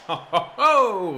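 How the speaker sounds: laughing ho-ho-ho leaping high and loud; delighted whoop. Real, not acted. Commentator as Timo applies the finish, delighted whoop. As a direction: burst into a gleeful, rising whoop. Cheering Timo's finish as it happens.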